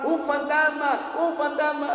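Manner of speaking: high, loud cries of alarm; frightened shout. Retold or imitated. Disciples crying out at a ghost, frightened shout. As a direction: cry out in alarm, twice over. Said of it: "Y todos gritaron".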